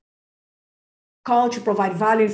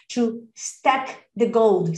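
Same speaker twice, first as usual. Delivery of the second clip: slow emphatic; much slower than usual. Shown as a demonstration. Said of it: "coach stressing key phrase".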